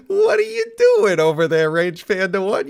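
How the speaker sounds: shrill high teasing call; mock high squeal. Mocking a fellow player's look.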